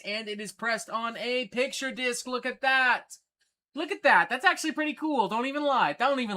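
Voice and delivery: thrilled showing off the record; excited. Real, not acted. Showing viewers the picture disc, excited high gush.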